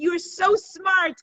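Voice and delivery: high, slow, gushing praise; proud grandmother marvelling, acted; delighted praise. Grandmother praises boy's clever trick.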